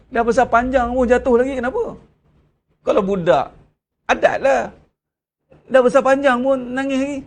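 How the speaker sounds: a hammed-up parent telling off; mock scolding. Parent scolding grown child.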